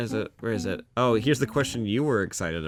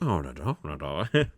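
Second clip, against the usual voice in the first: Italian impression; 6 semitones lower, 3 dB quieter than usual. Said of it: "what Italian sounds like to me".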